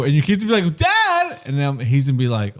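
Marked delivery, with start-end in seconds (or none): 0.8-1.4 put-on kid voice. Voicing the kid.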